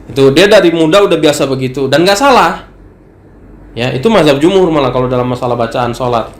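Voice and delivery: ordinary delivery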